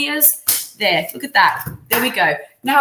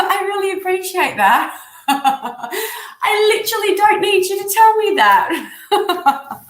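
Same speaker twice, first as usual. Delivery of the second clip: high-pitched mock indignation through laughter; laughing retort; 7 semitones higher than usual. Retelling her comeback to the tactless chiropractor, laughing retort. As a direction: crack up while protesting sarcastically.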